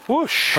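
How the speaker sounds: high whoosh imitating the flame. Real, not acted. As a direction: make the sound of the fire catching. Fire flaring, imitated sound.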